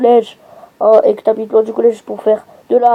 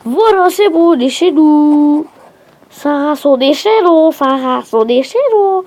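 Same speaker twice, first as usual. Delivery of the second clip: plus haut et plus fort, chez nous traîné; fêter le retour à la maison; soulagé et joyeux; 4 semitones higher than usual. Real, not acted. Rentre enfin à la maison. (joie haute et lente: on est chez nous)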